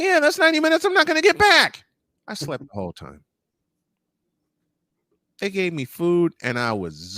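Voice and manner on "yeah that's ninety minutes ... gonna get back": high, loud, fast wisecrack; grumbling about losing ninety minutes, high and loud; sarcastic retort. Retold or imitated. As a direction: fire it back with loud sarcasm. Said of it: "I said".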